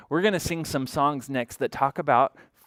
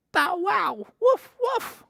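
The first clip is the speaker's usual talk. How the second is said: very high yappy puppy barking; slower than usual. A narrator's reading. The puppies bark at the farm animals, high yaps.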